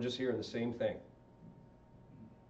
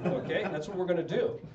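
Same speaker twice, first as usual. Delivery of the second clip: ordinary talk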